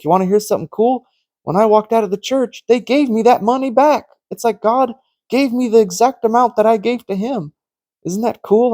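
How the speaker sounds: high, quick retelling of excited news; excited friend. Retold or imitated. Friend telling him the money came back, excited and high. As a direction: gush like he's sharing a miracle.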